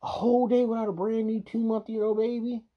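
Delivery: ranting as if it were him; imagining himself as the parent, loud and incredulous; exasperated outburst. Pretend. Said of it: "if it was me, it would've been like".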